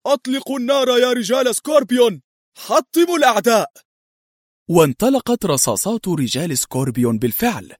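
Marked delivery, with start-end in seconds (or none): 0.1-3.7 high-pitched frantic battle order; shouted command. A narrator's reading. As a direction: yell the attack order to your men in a raised pitch. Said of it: "وهو يصرخ". (صراخ بأمر الهجوم)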